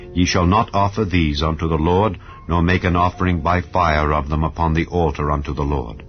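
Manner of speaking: ordinary delivery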